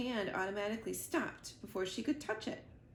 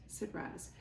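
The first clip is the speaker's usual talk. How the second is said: ordinary delivery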